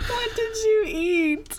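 high, slow, teary laughing question. Real, not acted. Wife asking husband through tears of laughter. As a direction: squeeze out the question mid-laugh.

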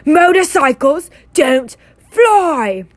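loud, slow, high furious yell; red-faced uncle roaring. A narrator's reading. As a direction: bellow it at the boy in the back seat. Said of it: "yelled at Harry".

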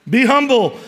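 laughing. Real, not acted. Laughs at his own joke.

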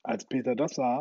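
ordinary delivery

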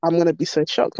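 ordinary talk

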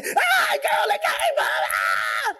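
squealing very high with delight; excited shriek. Retold or imitated. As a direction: shriek like you just saw your name on caller ID. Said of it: "They say".

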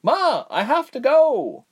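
high, slow called reply; young man calling. A narrator's reading. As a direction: call out urgently to Ma. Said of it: "Berg called".

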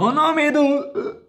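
sudden high, slow squeak; mock high voice. Teasing a friend on the podcast, high slow squeak.

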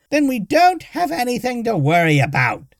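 put-on high, loud old woman cutting in; cutting him off. A narrator's reading. Old woman cutting off the barbarian, dismissive. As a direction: snap in over him like a stubborn old woman. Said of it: "Tiggy cut him off".

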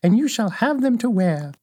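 put-on high-pitched old witch voice; kindly old witch. Old witch giving Dorothy the silver shoes, high kindly witch voice.

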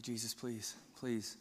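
hushed, nervous prayer under the breath; small, quiet imitated plea. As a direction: plead very softly and low, retelling your nerves. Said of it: "I'm so nervous".

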